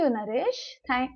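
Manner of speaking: usual speaking voice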